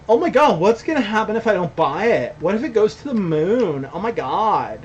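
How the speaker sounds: put-on high, breathless valley girl panic; mock valley girl freaking out about missing the pump. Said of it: "That was just me being a valley girl".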